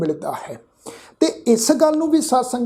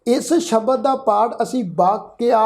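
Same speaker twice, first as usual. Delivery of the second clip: normal talking voice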